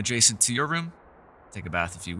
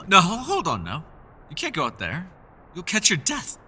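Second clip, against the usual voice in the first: alarmed, higher call to stop someone; anxious raised warning; urgent plea; 7 semitones higher, 3 dB louder than usual. Acted. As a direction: rush to stop them leaving. Stopping guest from leaving into storm.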